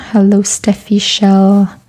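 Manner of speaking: ordinary talk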